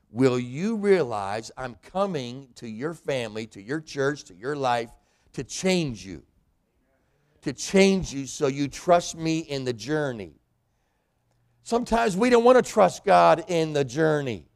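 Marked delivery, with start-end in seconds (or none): 0.1-10.4 God reassuring the listener, softly; gentle voice of God. Retold or imitated. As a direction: speak God's reassurance gently. God's voice speaking to you.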